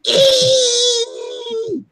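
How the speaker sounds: very high, long screeching squeal; imitated baby scream. Telling friends of a cicada stuck in his hair, imitated screech. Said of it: "it sounded like a baby screaming".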